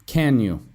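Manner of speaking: CAN YOU falling, not asking; intonation down. Shown as a demonstration. Teacher isolates the question tag for learners, intonation down. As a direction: let CAN YOU sink like a statement. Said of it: "Intonation down".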